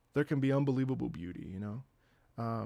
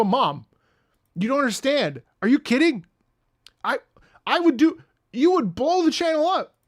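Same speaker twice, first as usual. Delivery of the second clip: loud, high, incredulous protest; acted-out outburst of a frustrated son; exasperated pleading; 10 semitones higher, 10 dB louder than usual. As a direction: plead with mom at the top of your voice. Acts out pleading with mom.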